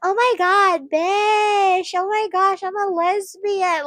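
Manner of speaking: exaggerated high-pitched squeal, drawn out at the end; gushy parody in a high voice. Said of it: "how does she expect you to talk".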